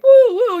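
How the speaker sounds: very high, loud whooping; mock crowd cheer. Imitates everyone cheering.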